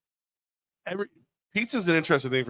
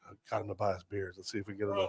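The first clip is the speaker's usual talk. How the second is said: ordinary talk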